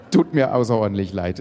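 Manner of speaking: ordinary delivery, nothing marked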